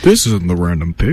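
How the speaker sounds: low-voiced baffled radio listener; confused listener. Co-host voicing someone who just tuned in, confused listener. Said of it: "You're thinking".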